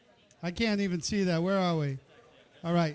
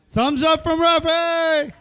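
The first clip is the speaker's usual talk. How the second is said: loud slow announcement; 5 semitones higher, 9 dB louder, slower than usual. Real, not acted. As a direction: stretch out "Thumbs up from Rafi!" loud and higher. Host announces judge's verdict.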